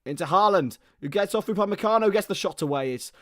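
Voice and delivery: live match commentary building to the shot; play-by-play. Acted. Commentator calling the match for viewers, play-by-play. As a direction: call the attack as it happens.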